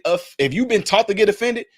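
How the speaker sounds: ordinary talk, nothing marked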